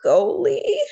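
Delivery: high, loud, rising question; dismayed disbelief. A narrator's reading. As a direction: echo it in alarmed disbelief. Dismayed pupil given the job.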